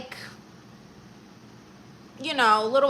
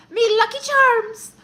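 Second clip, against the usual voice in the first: high, loud hammed-up Irish accent; cartoon Irish accent, mock-horrible; bad Irish accent; 11 semitones higher, 12 dB louder than usual. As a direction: squeal it like a terrible Irish leprechaun. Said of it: "I should do an Irish accent".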